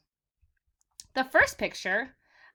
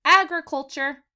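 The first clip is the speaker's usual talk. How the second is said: clear, louder model of the word; model word for repeating; 2 semitones higher, 7 dB louder than usual. Shown as a demonstration. Teacher modelling a new word for kids to repeat, clear and loud. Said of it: "everybody? Say".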